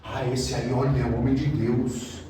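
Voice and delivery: hushed mock reverence; mock awed churchgoer. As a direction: imitate a gullible fan of the preacher. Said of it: "Tem gente que gosta e cai nessa".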